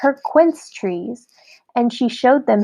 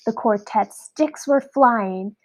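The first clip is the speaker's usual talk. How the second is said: ordinary delivery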